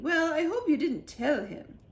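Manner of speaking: worried regal scolding; the Queen. A narrator's reading. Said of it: "said the Queen".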